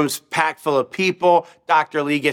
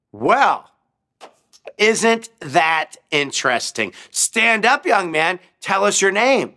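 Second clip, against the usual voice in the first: higher, slow, taunting professor; mocking professor; 8 semitones higher than usual. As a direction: sneer at the young man. Professor mocking a believing student.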